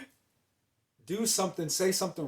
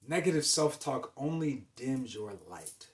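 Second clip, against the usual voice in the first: usual speaking voice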